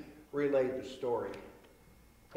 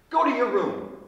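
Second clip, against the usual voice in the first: loud, higher stern dad order; 7 semitones higher, 9 dB louder than usual. Acted. Flustered dad ordering his son back to bed, loud and stern. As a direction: bark him off to bed like a flustered father.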